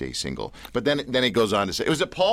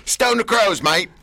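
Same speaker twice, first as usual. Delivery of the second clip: higher, louder mock Aussie accent; hammed-up Aussie accent; 10 semitones higher, 7 dB louder, slower than usual. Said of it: "a bit of the Aussie accent".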